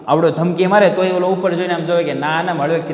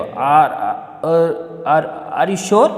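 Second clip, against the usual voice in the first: "are" repeated haltingly with pauses before "you sure"; much slower than usual. Acted. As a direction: stumble on each "are", then finish the question. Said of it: "આપણે એમ કે અટકે અટકે".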